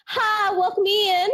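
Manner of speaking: high Southern drawl on welcome in; Southern greeting. Retold or imitated. Retail clerk greeting shoppers, high Southern lilt. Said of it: "a little bit of the South, like, just kind of kicks in".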